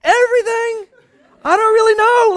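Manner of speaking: high, teary laugh on the words; laughing through tears. Retold or imitated. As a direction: laugh it out while near crying. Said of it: "I'm like".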